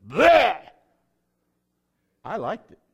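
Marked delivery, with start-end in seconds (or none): 0.1-0.6 loud, high mock gag of disgust; hammed-up loud gross-out blurt; disgusted bleh. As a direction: spit out a loud yuck noise. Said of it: "Some of you say".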